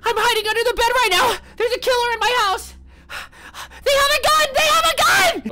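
very high, loud frantic pleading; mock panicked caller. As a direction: scream for help in mock terror. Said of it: "You're like".

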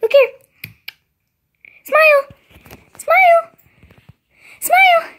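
loud, very high sing-song call; excited high call. Real, not acted. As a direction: squeal it to get her attention. Coaxing dog to smile.

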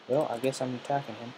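ordinary talk

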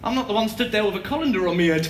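normal talking voice

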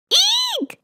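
squealed mock scream, way up in pitch; high storybook scream. A narrator's reading. PJ waking from a bad dream, small high scream. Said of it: "screamed P J when he woke up".

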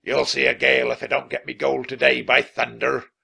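captain shouting at his crew about gold; shouted threat. A narrator's reading. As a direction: thunder it like a greedy pirate. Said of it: "Captain Bones shouted".

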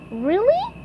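high, squeaky questioning voice; SpongeBob puzzled. Acted. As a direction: ask it puzzled, in a cartoon pipsqueak voice.

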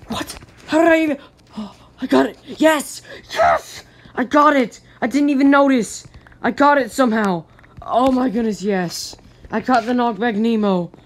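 shrieking with delight, high and loud; excited. Real, not acted. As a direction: squeal with real joy. Thrilled at getting rare item.

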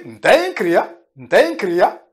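Mtenkria said as a statement with a falling close; affirming statement. Shown as a demonstration. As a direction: state it, waiting only for agreement. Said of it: "Je peux même utiliser ça et dire".

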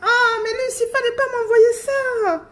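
high, laughing and near tears; overjoyed. Real, not acted. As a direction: gush with delight at the present. Overjoyed at gift. (voix aiguë, ravie, au bord des larmes)

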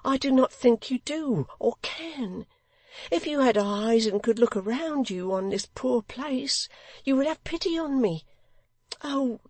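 high-pitched, distressed woman's voice; anguished, high appeal; pleading widow. A narrator's reading. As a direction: beg him for sympathy, pitched high throughout.

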